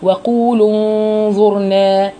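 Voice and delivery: وقولوا with the و cut before انظرنا; don't stretch the و of وقولوا, slide into انظرنا; short و before sukun. Shown as a demonstration. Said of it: "شوفوا انا بقولها ازاي".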